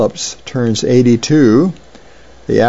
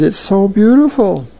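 a mild teary voice, pitched higher; sniffly, teary awe; 9 semitones higher than usual. Retold or imitated. A son moved to tears by the waterfall, lightly teary. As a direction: voice a boy choked up by the view. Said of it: "he sniffs and he says".

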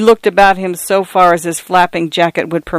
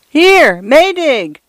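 desperate call; 9 semitones higher, much slower than usual. A narrator's reading. Mister Fotheringay calling Maydig over the uproar, screaming. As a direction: cry out against the roaring wind. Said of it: "screamed Mister Fotheringay's feeble voice".

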